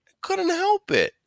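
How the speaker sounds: put-on high, whiny kid's excuse; defensive little whine; whining. A narrator's reading. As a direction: whine it like a caught child. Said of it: "kind of whining".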